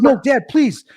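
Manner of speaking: high, urgent retold begging; pleading child. Retold child begging dad to check scalp.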